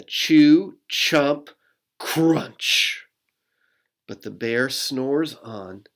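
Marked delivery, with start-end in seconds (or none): 0.0-3.0 each sound word punched out loud, like a bite; chewy sound words. Acted. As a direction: chomp through the sound words with relish. Acting out eating sounds.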